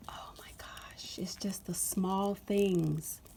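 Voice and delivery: soft whisper, slow, moved; quiet whispered awe; emotional whisper. Real, not acted. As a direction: whisper it as if almost tearing up.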